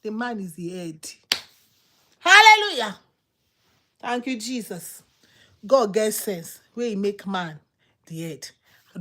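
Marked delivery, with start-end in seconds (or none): 2.2-3.0 high, loud exclamation; shouted praise. Real, not acted. Preacher praising God, loud high shout. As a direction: cry it out in exultant praise.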